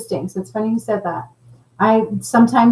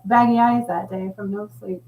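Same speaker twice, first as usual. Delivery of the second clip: normal talking voice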